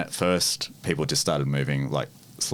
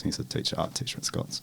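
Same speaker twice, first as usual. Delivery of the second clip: hushed, voiceless aside; whisper; 6 dB quieter than usual. Real, not acted. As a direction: whisper it so nobody overhears. Gossiping about a colleague.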